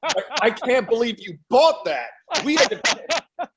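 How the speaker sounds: high, loud, laughing words; laughing disbelief. Real, not acted. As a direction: talk through real laughter, incredulous. Amused disbelief, laughing with others.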